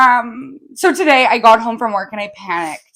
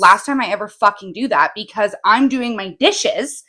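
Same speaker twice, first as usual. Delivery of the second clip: ordinary talk, nothing marked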